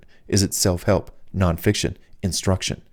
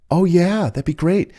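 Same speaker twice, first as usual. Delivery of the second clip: higher, keen party guest; eager woman; 10 semitones higher than usual. Retold or imitated. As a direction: gush like a delighted woman at the party. Party ladies answering eagerly.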